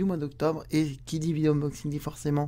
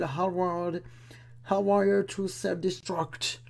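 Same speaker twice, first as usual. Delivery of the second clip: English title; 3 semitones higher, much slower than usual. Shown as a demonstration. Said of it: "dernier album officiel".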